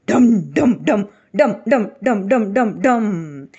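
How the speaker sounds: loud rhythmic dum beats; knocking sound. A narrator's reading. Narrator drums out the eggs' knocking sound. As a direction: pound out each dum like a drum.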